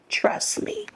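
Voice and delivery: breathy whisper, almost no voice; half-whispered warning. Real, not acted. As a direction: whisper it as a threat. Warning her former tormentor.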